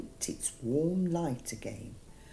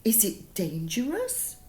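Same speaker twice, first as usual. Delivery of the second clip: mildly worried; 8 semitones higher than usual. Acted. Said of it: "cried Baby Potato softly".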